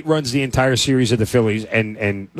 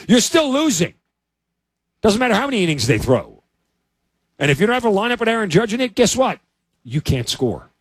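heated rant; 9 semitones higher than usual. Real, not acted. Analyst ranting to co-host about Yankee hitting, excited. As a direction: pitch jumps high, faster.

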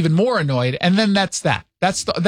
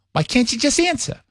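higher, impatient New York mom impression; mother's New York accent; 6 semitones higher than usual. Mother presses son for an answer, impatient New York accent. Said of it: "talks like this all the time".

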